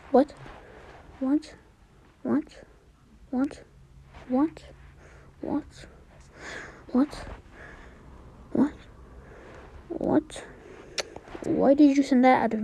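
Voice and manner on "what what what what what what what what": hushed, repeated disbelief; stunned muttering. Real, not acted. Player baffled by what just happened, muttering quietly. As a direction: keep saying it under your breath.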